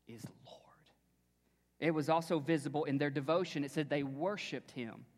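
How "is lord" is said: whisper. Acted. Sentence fades to whisper.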